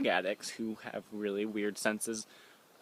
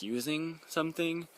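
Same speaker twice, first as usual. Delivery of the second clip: ordinary talk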